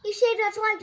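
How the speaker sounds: high, loud grateful cry; cried out. Acted. Nelson crying out in relief to his rescuer, loud and high. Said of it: "Nelson cried".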